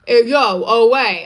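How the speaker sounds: loud, hammed-up New York Italian accent; bad Tony Danza accent. Showing chat her Italian accent, bad New York Italian. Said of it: "a bad Tony Danza accent".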